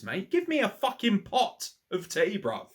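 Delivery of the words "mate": ordinary talk, nothing marked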